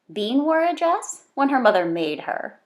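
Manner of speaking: ordinary delivery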